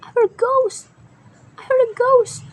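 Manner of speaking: high, loud panicked cry; frightened cry. Acted. Engine cries out to friends in fright. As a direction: blurt it out terrified.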